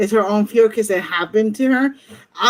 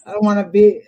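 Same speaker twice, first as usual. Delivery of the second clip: ordinary talk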